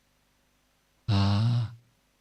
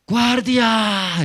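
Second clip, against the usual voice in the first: high, loud, drawn-out call to a guard; shout; 12 semitones higher, 8 dB louder than usual. Shown as a demonstration. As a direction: shout it up to someone on a high wall.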